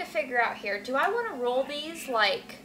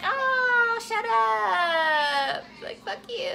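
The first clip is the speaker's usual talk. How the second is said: mock annoyed outburst; 8 semitones higher, much slower than usual. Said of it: "I'm like".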